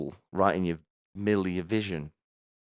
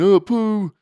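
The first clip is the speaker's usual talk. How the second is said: high, loud mocking yelp; mock toilet scream; 12 semitones higher, 8 dB louder than usual. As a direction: shriek it like a man straining on the toilet. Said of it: "while he screams on the toilet".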